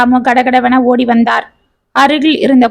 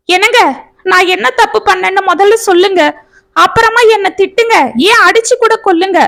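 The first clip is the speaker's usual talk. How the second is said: high pained plea, a touch fast at first; anguished pleading; 7 semitones higher than usual. A narrator's reading. Wife begs her husband to say her fault, anguished. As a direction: beg him to name the wrong. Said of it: "என்று கதறினாள் சந்தியா".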